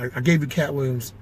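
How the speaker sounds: ordinary delivery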